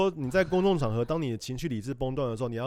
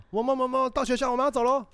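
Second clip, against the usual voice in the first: higher, louder sing-song wake-up; father calling his son to get up, higher and louder; coaxing dad; 12 semitones higher, 4 dB louder than usual. Retold or imitated. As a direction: call the kid awake, brighter and insistent. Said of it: "那我表演他今天早上发生的事".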